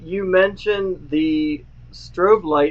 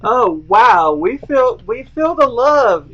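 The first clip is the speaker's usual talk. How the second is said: sarcastic; 7 semitones higher, 8 dB louder, slower than usual. Pretend. Mock hurt at viewers leaving.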